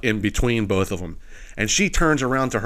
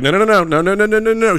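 louder and higher chanted no's; crowd protest; 8 semitones higher, 4 dB louder, faster than usual. Retold or imitated. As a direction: mimic a whole section yelling in protest. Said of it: "The whole section was like".

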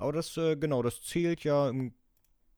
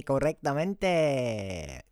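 mock Spanish; much slower than usual. Host jokingly says correct in Spanish.

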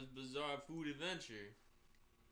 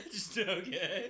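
loud, drawn-out words through laughter; real helpless laugh in the voice; 6 semitones higher, 7 dB louder, slower than usual. Real, not acted. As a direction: crack up while talking. Cracking up mid-conversation.

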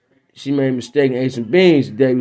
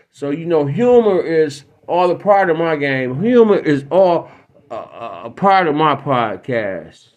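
ordinary delivery

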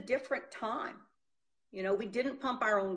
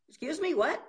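incredulous; 7 semitones higher, 4 dB louder than usual. Retold or imitated. Incredulous reply to mechanic.

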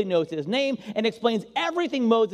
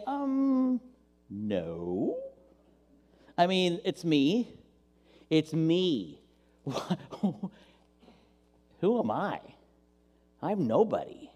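timid refusal; much slower than usual. Acted. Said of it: "take a step back and say".

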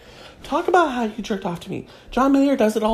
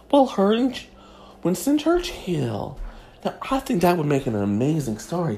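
ordinary delivery

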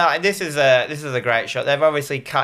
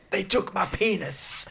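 high, soft, slow mock lament; a feeble, drawn-out, hammed-up whimper; imitated dying man; 6 semitones higher, 6 dB quieter, slower than usual. Host quoting the maimed man to Jake, mock pitiful lament. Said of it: "He looks at Jake and he goes".

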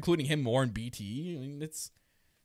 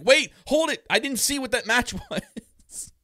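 much higher and louder, stop-them outcry; frantic protest; 10 semitones higher, 6 dB louder than usual. Retold or imitated. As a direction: call out to halt the rapid list. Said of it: "and I'm like".

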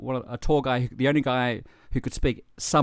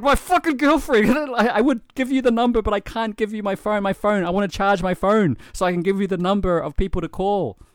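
much higher and louder, frantic; desperate plea; 10 semitones higher, 7 dB louder than usual. Retold or imitated. Man begging the lawyer to call his girlfriend, frantic. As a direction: blurt it out in a panic.

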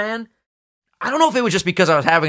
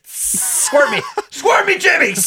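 joking high plea; slower than usual. Pretend. Joking call to a helper.

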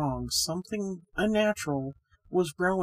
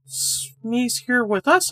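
higher, louder and slow protest; cried out; 6 semitones higher, 5 dB louder, much slower than usual. A narrator's reading. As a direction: insist it, drawn out in alarm. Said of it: "I cried".

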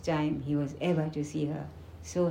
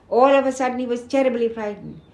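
plain reading voice

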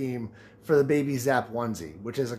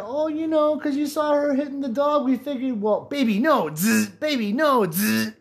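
mock dog scolding; 11 semitones higher than usual. Teasing reply mocking dog scolding.

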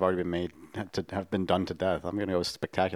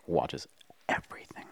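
whisper; 7 semitones lower, 6 dB quieter than usual. Acted. Hushed joke about brother.

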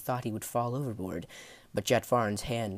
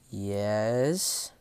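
through clenched teeth. Acted. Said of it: "teeth still clenched".

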